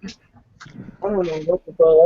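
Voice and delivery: ordinary talk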